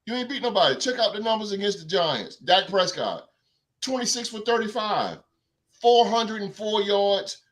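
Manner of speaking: ordinary delivery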